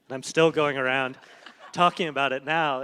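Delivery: a real laugh at her own endless project. Real, not acted. Laughing at herself mid-answer.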